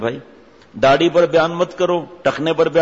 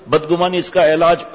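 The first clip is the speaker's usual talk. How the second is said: ordinary talk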